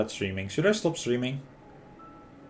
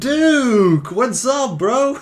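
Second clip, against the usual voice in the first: excited greeting; 14 semitones higher, 8 dB louder, much slower than usual. Real, not acted. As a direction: hail him like a long-lost bro. Greets game character excitedly.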